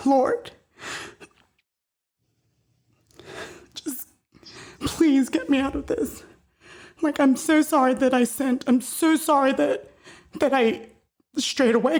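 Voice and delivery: tearful prayer. Real, not acted. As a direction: beg forgiveness with a catch in the throat. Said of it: "I don't know why I'm crying".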